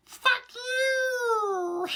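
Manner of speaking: very high squeaky fuck you. Pretend. Mocking the movie line in a squeaky voice. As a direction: squeak it out like a cartoon. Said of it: "just this one line is just".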